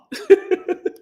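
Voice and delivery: sudden high-pitched laugh. Real, not acted. Reacts to kid's greeting.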